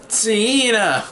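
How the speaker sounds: high, drawn-out imitated call; child finally speaking. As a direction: mimic a child straining out a name, pitched high. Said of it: "finally is able to speak".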